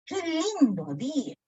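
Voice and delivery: "¡Qué lindo día!" pitched high on LINDO, then falling; exclamation intonation. Shown as a demonstration. Teacher showing how one exclaims, high exclamatory tune on "¡Qué lindo día!". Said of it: "cuando tú exclamas".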